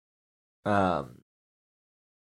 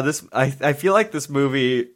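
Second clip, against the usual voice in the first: normal talking voice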